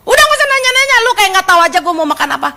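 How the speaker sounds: grumpy husband snap. Acted. Cranky husband snapping at his wife about dinner, fast and irritated. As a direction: brush her off like a cranky husband.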